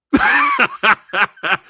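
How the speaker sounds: short loud high laugh. Real, not acted. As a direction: burst out laughing. Laughing at the story.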